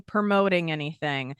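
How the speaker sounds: usual speaking voice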